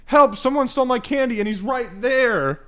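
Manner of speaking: high-pitched imitation of a wailing kid; hammed-up child calling for help, dragging the end; mock kid's plea. Robbed kid points out the bully, mock cry for help.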